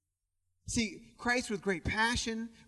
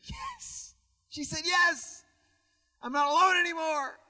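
excited proposal; 8 semitones higher than usual. Retold or imitated. Retelling Ruth's joyful yes to the congregation, excited. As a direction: act out the bride's overjoyed yes. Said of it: "he got excited".

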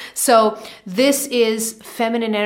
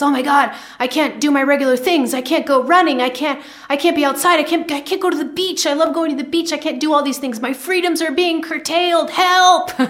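higher, building into a hammed-up cry of help; mock frustrated lament rising to a higher help; mock restless complaining; 5 semitones higher than usual. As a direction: playfully whine about being stuck indoors. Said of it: "I was feeling myself getting restless".

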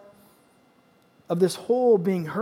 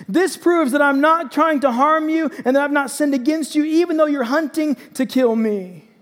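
high, raised-voice plea of innocence; shouted appeal; 6 semitones higher, 4 dB louder than usual. A narrator's reading. David shows Saul the piece of robe, shouting. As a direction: urgently call out your proof. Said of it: "And then he shouted to Saul".

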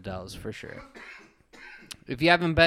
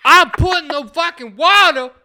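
much higher and louder furious yell; pissed-off yelling; 12 dB louder than usual. Retold or imitated. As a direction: scream back like he's been wronged. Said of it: "He was that fucking upset".

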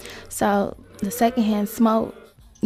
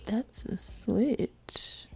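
whispering; 8 dB quieter, much slower than usual. Pretend. Testing mic levels, trying voices.

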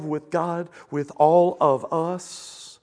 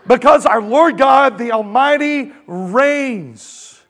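loud, high, booming scripture reading; ringing, emphatic pulpit proclamation; full-force preaching; 9 semitones higher, 11 dB louder, slower than usual. Real, not acted. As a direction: proclaim it to the whole crowd.

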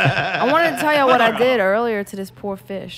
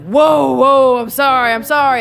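startled apology; 3 semitones higher, 7 dB louder than usual. Retold or imitated. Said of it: "And I was like".

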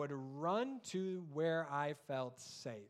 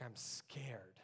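put-on whispered, small and frightened child; father voicing daughter's whisper; scared child whisper; 6 semitones lower, 10 dB quieter than usual. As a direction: whisper it like a frightened little girl. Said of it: "And she's like".

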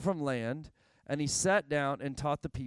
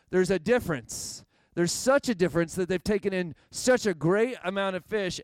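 louder, driving sermon build; preaching; 4 semitones higher, 7 dB louder than usual. Real, not acted. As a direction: press the point home to the congregation. Preaching builds at full force.

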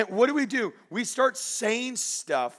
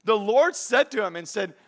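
ordinary delivery

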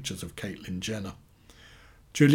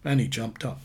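plain reading voice

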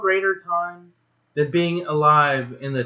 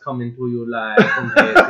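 mock Jamaican accent; 7 semitones lower than usual. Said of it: "sounds like one of the Jamaican pirated podcasts".

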